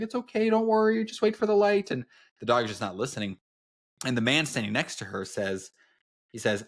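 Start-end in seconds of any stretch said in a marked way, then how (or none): 0.0-1.9 high, sweet coaxing voice, much higher; cooing at a puppy she can't discipline; soft doting owner. Retold or imitated. Author gently soothing her tugging puppy, high sweet coo. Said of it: "very soft with it".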